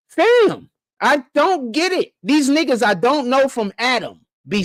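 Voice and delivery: high, drawn-out disbelief; exasperated bafflement. Real, not acted. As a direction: protest in sheer confusion, pitch shooting up. Baffled rant, cannot understand them.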